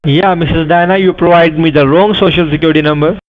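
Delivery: normal talking voice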